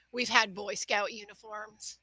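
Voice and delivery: a natural Midwestern lilt; Midwestern accent. Real, not acted. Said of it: "in the most Midwestern accent possible".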